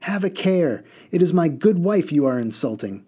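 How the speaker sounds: second mud man angrily cutting in; angry interruption. Acted. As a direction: defend your wife hotly. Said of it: "interrupted angrily".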